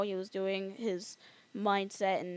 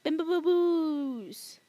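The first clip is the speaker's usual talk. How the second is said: silly voice; 7 semitones higher, 4 dB louder, slower than usual. Pretend. Silly B-word voice.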